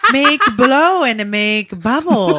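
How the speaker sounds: laughing through a silly made-up origin; slow, chuckling explanation. Real, not acted. Joking to her cohost, laughing as she explains.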